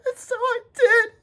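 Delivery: barely holding back tears; voice breaking. A narrator's reading. Retelling abuse, voice breaks.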